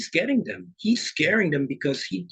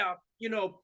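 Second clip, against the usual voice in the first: usual speaking voice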